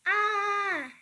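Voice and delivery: sudden high, loud shocked scream; mom's horrified scream at the flower bed. Acted. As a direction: let out a startled shriek. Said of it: "she screamed".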